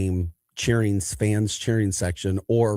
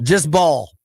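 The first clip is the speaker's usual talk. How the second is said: higher-pitched, mock-careless chant; teammate's call; 7 semitones higher, 6 dB louder than usual. Teammate repeating the defensive call, mock careless. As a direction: toss it off like a shrug. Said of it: "That was our defensive call".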